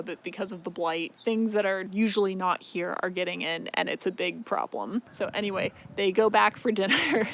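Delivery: ordinary delivery, nothing marked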